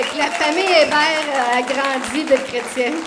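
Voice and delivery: loud and high, proud joy; jubilant. Real, not acted. Announcing the family's growth in faith to the church, jubilant. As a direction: proclaim the good news proudly. Joyful baptism news.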